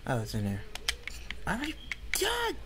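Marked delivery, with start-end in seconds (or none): 1.5-2.6 high, strained cry of frustration; frustrated yelp. Real, not acted. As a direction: let it break up high in annoyance. Frustrated gamer outburst.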